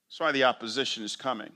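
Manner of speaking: ordinary talk, nothing marked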